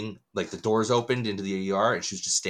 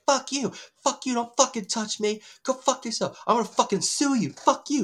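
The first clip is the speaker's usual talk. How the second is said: high-pitched imitated tirade; mock screaming; 14 semitones higher than usual. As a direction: imitate a woman screaming abuse at a guard. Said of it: "He's just screaming at this poor".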